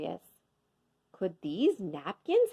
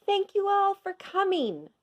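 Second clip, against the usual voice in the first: much higher formal welcome; mayor's welcome; 12 semitones higher than usual. A narrator's reading. Mayor welcomes the crowd, high and formal. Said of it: "the mayor said".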